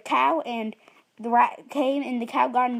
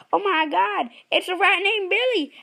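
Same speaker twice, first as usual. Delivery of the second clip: higher, louder, panicky cry; panicked farmer; 8 semitones higher, 5 dB louder than usual. Acted. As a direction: freak out at the rat. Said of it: "He was like".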